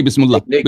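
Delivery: بسم اللہ misread as "bismullah"; mispronounced بسم اللہ. Shown as a demonstration. Debater quoting a sample error to his opponent, بسم اللہ mispronounced as "bismullah". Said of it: "آپ نے غلط پڑھی".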